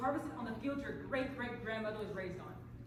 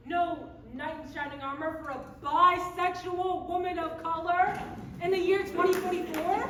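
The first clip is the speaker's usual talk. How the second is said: slow and louder, a dramatic plea; rising plea; 3 semitones higher, 8 dB louder than usual. Acted. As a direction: build it to the audience. Appeal to audience, building.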